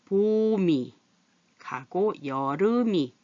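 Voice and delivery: 봄이 drawn out as "보-미", 여름이 as "여르-미"; slow teaching demo of "보미" and "여르미"; linked pronunciation. Shown as a demonstration. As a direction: carry the ㅁ into the next syllable: "보-미 가고 여르-미", slowly. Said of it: "이 밑에 미음이 일로 가서".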